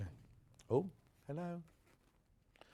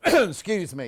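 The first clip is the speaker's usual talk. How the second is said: a louder mock-stern "Excuse me," to hush the room; mock call to order; 3 semitones higher, 8 dB louder than usual. Host mock-scolding her excitable viewers, louder and playful. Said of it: "it's getting overexcitable in here".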